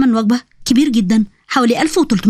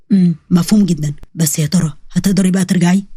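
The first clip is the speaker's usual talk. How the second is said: low, knowing man's voice; mysterious man; 6 semitones lower than usual. A narrator's reading. Mysterious man doubts she can return, low knowing voice. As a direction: drop low for the enigmatic stranger.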